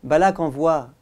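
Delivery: ordinary talk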